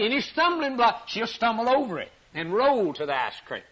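high, insistent pulpit cry; impassioned high-pitched exhortation; fervent preaching. Real, not acted.

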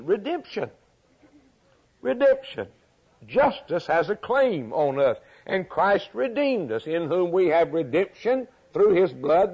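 high, emphatic pulpit proclamation; impassioned preaching. Real, not acted. Preacher proclaiming redemption in Christ, impassioned.

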